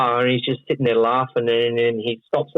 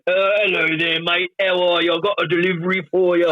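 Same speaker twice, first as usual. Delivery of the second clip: put-on English accent, pitched up; Englishman; 7 semitones higher than usual. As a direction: play a chirpy English delivery man. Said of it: "You're speaking like an, a freaking Englishman right now".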